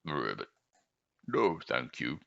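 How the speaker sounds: low frog croak. Acted. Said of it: "the frog smiled".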